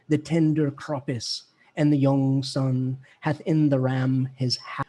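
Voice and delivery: slow, low Chaucer read in Middle English; Middle English recitation. Shown as a demonstration. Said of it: "let me do that one real quick".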